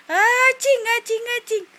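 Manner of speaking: high-pitched string of pretend sneezes; playful mock sneeze. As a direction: toss out little fake sneezes for a baby. Repeats the pretend sneeze for baby. (sunod-sunod na kunwaring bahing, matinis)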